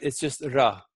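"ra" with one light tongue tap; single flap ra. Shown as a demonstration. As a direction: flick the tongue once for "ra". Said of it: "just a single flap".

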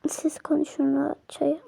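whispered aside, leaving them alone. Real, not acted. Friend whispering while leaving the couple to argue. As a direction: breathe it out as you slip away.

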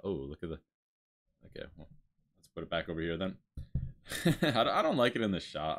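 ordinary talk, nothing marked

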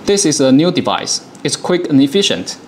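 ordinary talk, nothing marked

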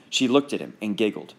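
plain reading voice

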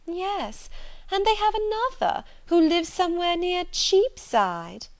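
high, drawn-out mocking gossip; gleeful snobbery. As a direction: deliver the relatives as a delicious scandal. Sister mocks low connections in story.

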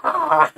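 mock big effort yell; energetic grunt. Joking about the toss, exaggerated effort grunt. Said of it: "energetic grunting at the end".

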